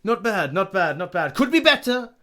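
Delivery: high, loud, theatrical ballet master. Acted. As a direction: dole out praise like a fussy teacher. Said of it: "Mister Hattori is like".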